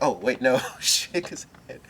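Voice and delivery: mock-panicked self-correction; flustered. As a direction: act out catching your own blunder. Retold handshake fumble, nervous wreck.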